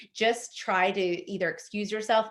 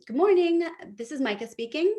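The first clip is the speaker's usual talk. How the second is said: bright phone greeting; 7 semitones higher than usual. Shown as a demonstration. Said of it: "you could even say".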